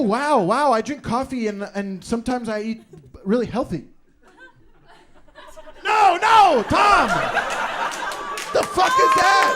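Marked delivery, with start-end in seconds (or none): 5.8-9.5 high, loud horrified shout; shocked yell. Real, not acted. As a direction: cut him off with a yelling no. Host cutting off bad roleplay.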